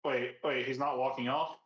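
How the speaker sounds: ordinary talk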